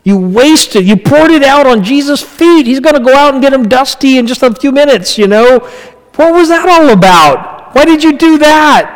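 high, indignant mock outrage; upset Judas. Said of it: "Judas was all upset".

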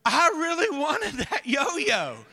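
put-on high, slow whine of a child; mock crying. Son crying to dad over the lost yo-yo, mock tearful. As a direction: wail it like a disappointed little kid, exaggerated. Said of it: "my son actually starts crying".

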